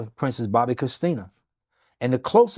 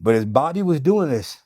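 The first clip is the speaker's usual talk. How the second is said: ordinary talk, nothing marked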